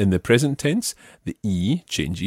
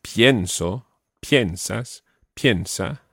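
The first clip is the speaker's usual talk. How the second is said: pienso, piensas, piensa each stressed on pien; stress on first syllable; slower than usual. Shown as a demonstration. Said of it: "the stress is on the first syllable".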